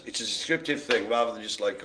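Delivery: usual speaking voice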